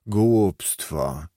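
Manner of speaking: drawn-out, scornful single word; dismissive repeat. A narrator's reading. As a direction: stretch it out in dismissal. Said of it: "powtórzył znowu". (przeciągłe, lekceważące burknięcie)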